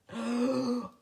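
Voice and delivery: sharp alarmed gasp. Real, not acted. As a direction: gasp in sudden panic. Fears she used wrong card.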